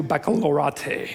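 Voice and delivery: baccalaureate ending in "AH-tay"; mispronounced baccalaureate. Real, not acted. Speaker to graduating class, baccalaureate playfully ending "AH-tay". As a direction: say baccalaureate as "back-a-lor-AH-tay". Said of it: "whatever, that's how it's pronounced".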